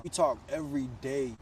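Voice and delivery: ordinary talk, nothing marked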